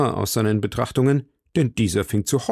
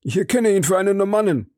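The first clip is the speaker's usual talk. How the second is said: the servant's voice, higher and proud; eager servant; 7 semitones higher than usual. Acted. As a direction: boast to the master, higher.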